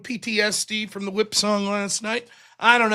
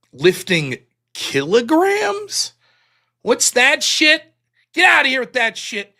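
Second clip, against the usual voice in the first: mock outrage; 4 semitones higher, 4 dB louder, slower than usual. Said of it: "Bunch of foreigners anyways".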